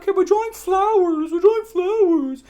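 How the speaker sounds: very high, loud singsong; shrieky falsetto mockery, stretched out; mock art teacher. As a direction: imitate a teacher trilling at the class. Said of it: "teacher would be like".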